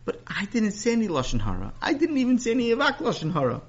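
put-on high, eager little boy; bright grateful kid, pitched high; young boy voice. Sammy voiced by narrator.

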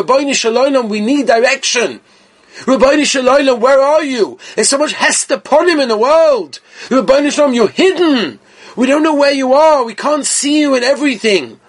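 high, impassioned cry to heaven; fervent preacher calling out to God; pleading prayer. Acted.

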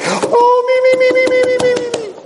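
a tiny suit begging to be chosen; squeaky suit voice. Acted. The eager suit in the closet, in a squeaky falsetto. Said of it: "And the suit's like".

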